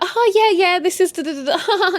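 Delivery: high, loud mock small talk; fake coupley chatter. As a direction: ham up the fake lovey couple.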